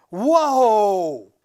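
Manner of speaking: a loud amazed whoa that should be whispered; failed whisper. Acted. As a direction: blurt the whoa out loud by mistake. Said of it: "That wasn't a very good whisper".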